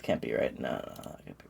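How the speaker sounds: whispered no no no under the breath; barely voiced disbelief, trailing off; despairing whisper. Real, not acted. Alone at the search, whispering in disbelief.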